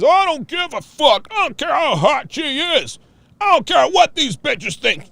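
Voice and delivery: high, put-on blustering man; mock tough guy. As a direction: overdo the indifferent tough-guy act. Mocks any man claiming not to care.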